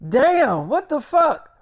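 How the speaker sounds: sudden loud, high curse at himself; real exasperated outburst after tripping over words; frustrated outburst. Real, not acted. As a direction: snap at your own fumbling, loud and high. Annoyed at his own stuttering.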